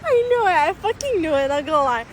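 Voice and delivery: ordinary talk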